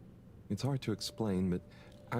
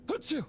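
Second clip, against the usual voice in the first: sneeze. Acted. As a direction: let out a big sneeze. Character sneezes.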